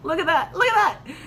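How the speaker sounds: really excited, building with each "look at that". Real, not acted. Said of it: "I'm just really excited".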